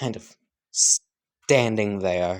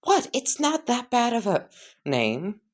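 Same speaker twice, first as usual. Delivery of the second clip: surprised; 9 semitones higher than usual. Acted. Deku reacts to his new name.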